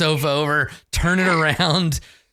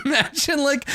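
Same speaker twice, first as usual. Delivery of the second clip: ordinary talk, nothing marked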